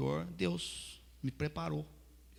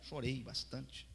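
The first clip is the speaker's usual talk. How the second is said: ordinary delivery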